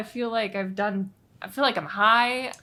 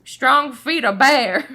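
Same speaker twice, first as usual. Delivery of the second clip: slow, louder mock folksy line; mock Southern proverb; 2 semitones higher, 8 dB louder, much slower than usual. Joining the joke, slow mock folksy Southern line. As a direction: drawl it out like porch wisdom.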